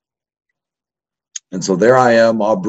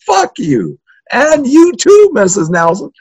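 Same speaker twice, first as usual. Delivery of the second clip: much higher, louder, yelling at the teacher too; defiant yell; 5 dB louder, slower than usual. Retold or imitated. Kid on his knees yelling at the teacher, defiant. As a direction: let it fly at both of them. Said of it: "I said".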